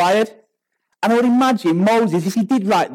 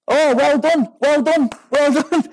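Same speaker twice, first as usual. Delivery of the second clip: high, mocking praise; sarcastic congratulations; 8 semitones higher than usual. Moped rider to the self-important driver, acted sarcasm. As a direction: belittle the boast with fake applause.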